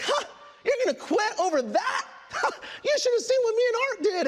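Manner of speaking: high-pitched mock-incredulous woman with a scoffing laugh; scoffing mentor. Mentor dismissing his wish to quit, high scoffing voice.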